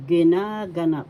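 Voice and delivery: ginaganap said loudly with a long NA; stress on second syllable. Shown as a demonstration. Teacher modelling for learners, loud ginaganap stressed on NA. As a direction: punch and stretch NA in ginaganap. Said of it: "or in the second".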